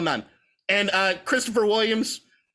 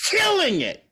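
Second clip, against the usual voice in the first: excited praise; 10 semitones higher than usual. Real, not acted.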